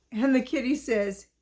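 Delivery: ordinary delivery, nothing marked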